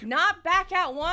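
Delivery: loud insistent yell; shouting. Real, not acted. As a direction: shout him down. Yelling correction.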